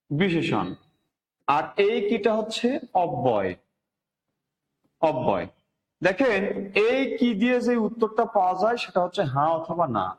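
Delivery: usual speaking voice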